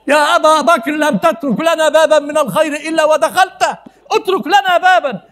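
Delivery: high, loud, half-laughing complaint; Umar's teasing protest to Abu Bakr; Umar pleading with Abu Bakr. Acted. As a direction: playfully beg him to leave a good deed for you. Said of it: "سيدنا عمر بيقول لسيدنا أبو بكر".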